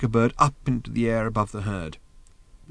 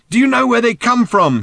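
high, loud called-out question; shouting down; 13 semitones higher, 8 dB louder than usual. A narrator's reading. Arthur on the beast questions the old man, shouting. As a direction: yell it to someone far below. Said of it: "Arthur shouted down at Thrashbarg".

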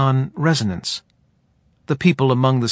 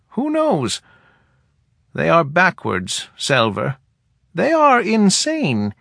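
put-on higher, dry old woman; wry, higher elder woman voice; head woman voice; 5 semitones higher than usual. A narrator's reading.

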